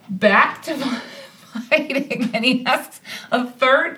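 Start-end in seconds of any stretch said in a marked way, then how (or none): none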